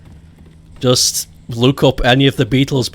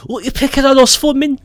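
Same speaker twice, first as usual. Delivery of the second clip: high, complaining Scouse; Scouse accent; 12 semitones higher than usual. Shown as a demonstration. Liverpudlian asking why he's picked on, high Scouse. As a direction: protest in a high mock Scouse voice. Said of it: "definitely say in Scouse".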